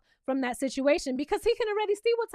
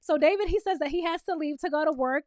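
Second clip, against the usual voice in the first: normal talking voice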